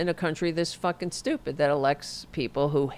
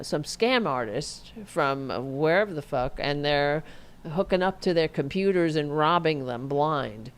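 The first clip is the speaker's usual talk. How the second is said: ordinary talk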